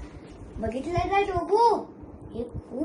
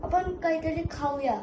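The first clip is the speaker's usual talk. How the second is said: normal talking voice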